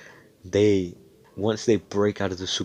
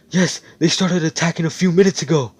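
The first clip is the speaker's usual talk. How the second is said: higher, louder urgent report; urgent shout; 10 semitones higher, 8 dB louder than usual. Acted. Mage answers Asta about the attack, urgent raised voice. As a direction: call it out in a rush of alarm.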